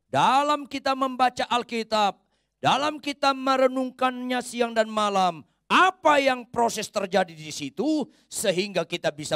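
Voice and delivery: high, loud and slowed, every phrase driven home; full-force preaching. Acted. Preacher rousing the congregation on Bible reading, full-force preaching.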